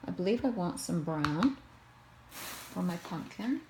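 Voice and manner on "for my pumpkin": ordinary delivery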